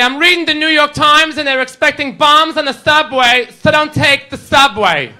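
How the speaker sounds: worried mom. Retold or imitated. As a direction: mom fretting down the phone. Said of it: "She says to me".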